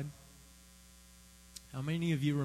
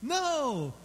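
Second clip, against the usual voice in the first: cat refusing; 13 semitones higher, 5 dB louder than usual. Retold or imitated. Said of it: "she's going".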